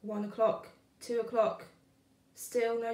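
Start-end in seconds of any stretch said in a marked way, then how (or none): none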